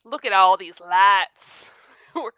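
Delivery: hammed-up Kentucky drawl, slow and loud; thick Southern accent. Friends in a big city, mock yokel Kentucky accent, slow and loud. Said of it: "lay on your accent really thick".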